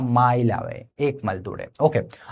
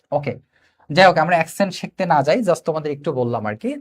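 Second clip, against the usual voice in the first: ordinary talk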